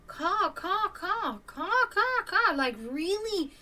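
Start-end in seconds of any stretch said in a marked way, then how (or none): 0.1-2.5 high, sharp hawk caws, steadily repeated; hawk caw imitation. Showing viewers the hawk's call, high mimicked caws. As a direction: screech the caws high like a bird.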